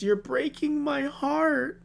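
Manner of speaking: high, drawn-out wail of heartbreak; melodramatic mock quote. Host mocking a tragic film line, high melodramatic wail. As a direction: stretch it high, slow and overdone.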